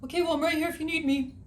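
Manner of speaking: a quick, eager little guard dog; voicing the dog. Dog reassuring owner outside the shower. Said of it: "dog answers".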